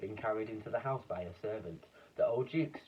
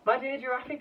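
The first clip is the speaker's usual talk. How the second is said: high, loud exclaiming; cry; 6 dB louder than usual. Acted. Duke exclaiming to the giraffe, high and loud. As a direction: gush apologetically. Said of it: "cried the Duke".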